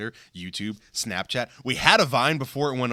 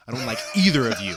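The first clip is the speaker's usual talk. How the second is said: usual speaking voice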